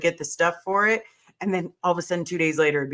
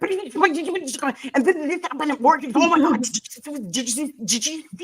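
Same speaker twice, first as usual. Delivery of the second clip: high, breathless rambling friend; hyper chatter; 7 semitones higher than usual. Retold or imitated. Imitating a hyper friend babbling about work, high and breathless. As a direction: rattle on like you're wired.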